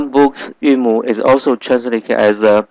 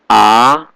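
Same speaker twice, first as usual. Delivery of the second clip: A rising like a question; second tone; 4 dB louder than usual. Shown as a demonstration. Teacher showing students the tones, rising second-tone A. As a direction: lift A upward through the vowel. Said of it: "is going up".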